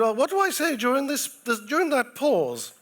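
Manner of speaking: high, loud mock actress voice; imitating the actress. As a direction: squeak it out like an anxious actress. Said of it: "She said".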